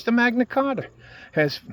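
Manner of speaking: "The Magna Carta" with a Boston twang on the vowels; accidental Boston accent. Real, not acted. Ranting about free speech, he slips into a Boston accent. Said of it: "I sound like I'm from Boston".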